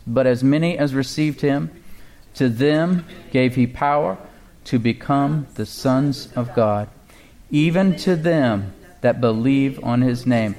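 "But as many as received him" read very slowly; slow, deliberate reading, a pause at each phrase; very slow scripture read; read real slow. Shown as a demonstration. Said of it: "Read it real slow".

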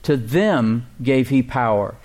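"To them gave he power" read slowly, weight on "them"; slow key-phrase reading; slow, emphatic reading. Shown as a demonstration. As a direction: dwell on every word. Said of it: "That phrase is key".

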